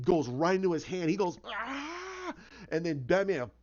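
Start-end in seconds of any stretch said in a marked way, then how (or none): 1.5-2.3 high, drawn-out quiet scream; mock agonized "ah"; held-back pretend scream; imitated pain cry. Joker cries out, hand hit.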